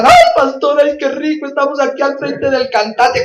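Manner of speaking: put-on excited girl. Excited girls answer the pastor.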